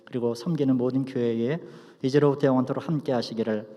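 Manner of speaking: hushed, low benediction prayer. Acted.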